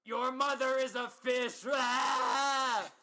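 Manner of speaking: loud, high slow shout then scream; death cry. Retold or imitated. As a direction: scream your last words. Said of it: "her death cry was".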